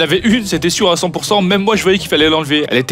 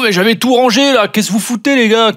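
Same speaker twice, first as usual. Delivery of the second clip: annoyed shout; 5 semitones higher than usual. Acted. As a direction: shoo them away in a huff. Tooth voiced shooing others.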